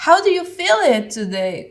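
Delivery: high, loud model question; emphatic teaching model. Shown as a demonstration. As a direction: pitch it up and project for learners. Teacher models English question for learners.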